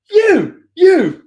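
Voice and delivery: high, jabbed accusing words; single him out like a furious groom; mock angry accusation. Groom confronting the musician.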